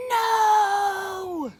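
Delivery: loud high stubborn "No!"; defiant shout. Acted. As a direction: yell it like a cross little boy. Ned refuses, story climax.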